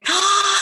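high thrilled shriek of joy; excited gasp. Real, not acted. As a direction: let out a burst of delight. Sixteen women showed up. (grito agudo de alegría)

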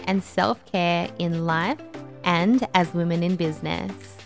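polished upbeat show intro; podcast intro voice. Acted. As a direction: read it like a podcast opener. Podcast intro over music.

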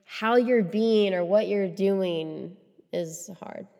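touched. Real, not acted. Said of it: "change the subject before I cry".

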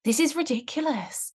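high, loud scornful scolding; scolding inner voice. Retold or imitated. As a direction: scold yourself with contempt. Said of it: "this person inside my head who's like".

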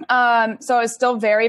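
normal talking voice

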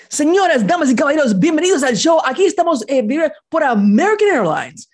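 high, loud sports announcer voice, Spanish. Retold or imitated. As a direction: belt it out like a Spanish arena announcer. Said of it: "Spanish announcers".

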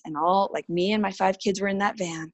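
ordinary talk

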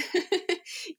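short high laugh; a quick, high chuckle mid-story; light laugh. Real, not acted. Laughs while telling birth story.

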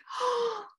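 high, delighted intake of breath; amazed gasp. Retold or imitated. Mother seeing herself holding her newborn, amazed gasp. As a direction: gasp as if overjoyed, re-enacted. First sight of newborn, retold.

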